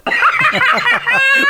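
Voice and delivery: real high squealing laugh; high cackle. Real, not acted. Host cracking up at the Cleveland joke, squealing laugh. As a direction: burst out laughing, high-pitched. Laughing at his own bit.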